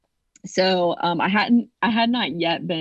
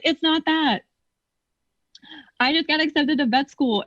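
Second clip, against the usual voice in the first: higher, eager reply; higher eager explanation; mock excited reply; 9 semitones higher than usual. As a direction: correct him excitedly. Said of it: "I was like".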